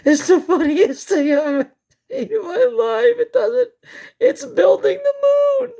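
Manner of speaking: words squeezed out high between real laughs; can barely get it out for laughing; laughing hard. Real, not acted. Watching the moon footage with viewers, helpless real laughter. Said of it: "I'm laughing 'cause I don't know what to tell you".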